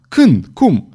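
two short, high-pitched cries; yelled questions. A narrator's reading. Queen demands answers from the astrologer, high mild yell. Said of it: "urlă regina".

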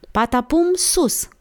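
high-pitched order to a dog; playful command. A narrator's reading. Girl commands dog in tricks. (comandă jucăușă, pe ton înalt)

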